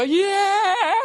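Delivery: breaks into a high laugh; real burst of laughter. Real, not acted. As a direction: laughs mid-sentence at the fans. Laughing at dancing fans.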